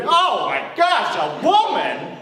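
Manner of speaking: loud, drawn-out scandalized outcry; mock horror. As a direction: imitate an appalled crowd, high and slow. Listeners' horror at the word woman.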